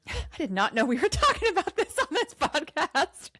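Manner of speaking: giggling in real surprise; laughing. Real, not acted. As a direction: laugh it out, caught off guard. Laughs at surprise podcast topic.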